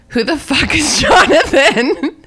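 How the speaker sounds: loud, high, laughing outrage; laughing disbelief. Retold or imitated. Friends in chat mocking her mistake, laughing shout. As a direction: cackle out the question in mock disbelief.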